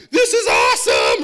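high, loud thrilled shout; young [name] gushing. Retold or imitated. Twenty-two-year-old [name] in a luxury car, thrilled shout. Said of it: "twenty-two-year-old [name] was like".

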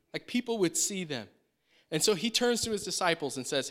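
ordinary talk

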